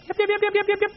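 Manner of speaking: very high, rapid yips; yappy Chihuahua. Retold or imitated. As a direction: yap like a tiny dog at a wolf. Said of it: "They'd run up to the wolf".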